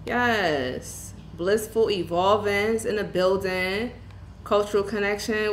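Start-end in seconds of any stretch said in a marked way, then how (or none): none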